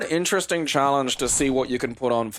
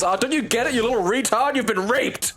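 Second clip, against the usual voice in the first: retold shout; 11 semitones higher than usual. Dad comes back and shouts at his bleeding kid, mock shouting. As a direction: imitate an exasperated dad's outburst, pitched way up. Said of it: "comes back and shouts".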